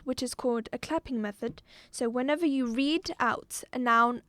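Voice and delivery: normal talking voice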